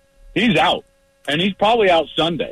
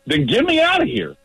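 exasperated; 13 semitones higher than usual. Real, not acted. Exasperated punchline.

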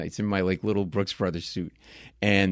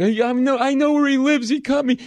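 very high, louder mock-drunk complaint; drunk man's cry; 5 dB louder than usual. Drunk victim telling bar who cut him, high mock wail. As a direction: imitate a hurt drunk protesting loudly. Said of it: "he was really drunk".

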